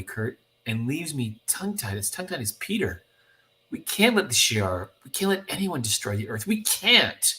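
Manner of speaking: lilting wonder building to earnest insistence; awestruck young heroine. Acted. Young hero telling a teammate Earth must survive, awed then insistent. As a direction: marvel breathlessly, then plead with rising pitch.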